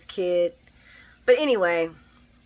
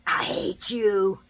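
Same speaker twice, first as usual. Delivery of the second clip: slow mock hatred; much slower than usual. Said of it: "She was like".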